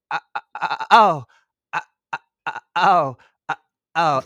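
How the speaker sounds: high, loud halting "uh"s breaking into "oh"; mock stuttering gasps. Mocking the actress's cringy scene, exaggerated stuttered gasps. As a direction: stammer in exaggerated little bursts. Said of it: "Kristen Stewart stutter".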